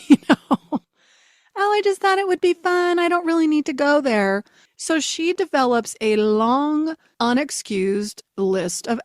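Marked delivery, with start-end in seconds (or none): 0.0-0.6 laughing through the words; a real laugh spilling into the question. Real, not acted. Laughing as she tells listeners the story. 1.6-4.4 put-on higher, carefree teenage voice; teen daughter. Daughter brushing off skipped class, breezy and higher.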